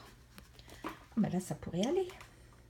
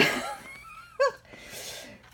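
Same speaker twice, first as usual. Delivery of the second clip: 1 high giggle; 3 dB quieter than usual. Real, not acted. Giggles before presenting the books.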